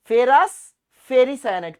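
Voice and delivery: Ferrous punched loud, then ferricyanide; stress on ferrous. Shown as a demonstration. Teacher making students remember ferrous, loud stress. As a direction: hit ferrous hard before ferricyanide. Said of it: "potassium ferrous ferricyanide".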